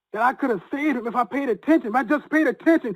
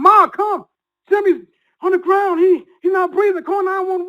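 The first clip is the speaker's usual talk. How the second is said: high, loud panicked yell for his mother; screaming for mom; 11 semitones higher, 4 dB louder than usual. Retold or imitated. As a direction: shout for help as if his dad just collapsed. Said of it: "And I scream to my mom".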